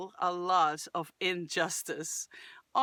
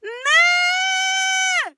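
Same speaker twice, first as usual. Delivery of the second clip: sudden yelled cry of pain; high, loud cry from frustration; frustrated shout; 10 dB louder than usual. Shown as a demonstration. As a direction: yell it like a kid denied ice cream. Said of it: "But they're doing this".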